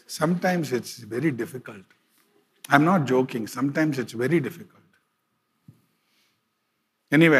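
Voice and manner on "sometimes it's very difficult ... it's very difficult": quiet confiding. Real, not acted. Confiding hardship of pastoral life.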